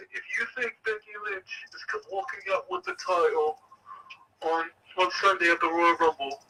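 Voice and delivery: teasing James in a light mock British accent. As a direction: put on a playful British accent for the bad news. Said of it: "You're welcome for that British accent".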